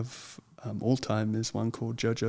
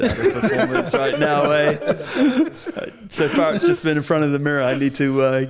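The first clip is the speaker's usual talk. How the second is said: laughing; 4 semitones higher, 9 dB louder than usual. Real, not acted. Embarrassed at being asked to perform.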